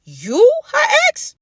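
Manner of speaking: high, slow, incredulous question; stunned disbelief. Pretend. Asking viewers if the boss is really her ex, high slow shock. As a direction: stretch it out in total shock.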